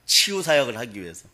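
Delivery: ordinary delivery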